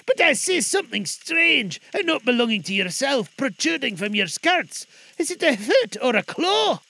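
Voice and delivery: put-on high, loud uneasy old man; Scrooge voice. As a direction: pitch up, unsettled and probing. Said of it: "said Scrooge".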